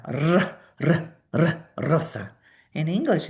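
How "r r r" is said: rolled Spanish r; trilled r. Shown as a demonstration. Teacher showing the Spanish trill. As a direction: roll the r hard. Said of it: "we have a trill R".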